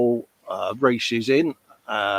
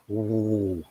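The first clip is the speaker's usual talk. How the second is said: longing sigh; 5 semitones lower, 8 dB quieter than usual. Real, not acted. Longing for an English fry up.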